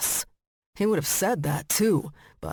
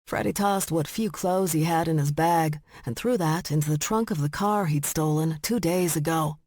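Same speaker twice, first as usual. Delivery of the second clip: ordinary delivery, nothing marked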